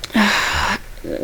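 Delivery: long breathy sigh, no words; heavy sigh. Retold or imitated. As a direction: let out a heavy, deflated sigh. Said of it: "And Orange is like".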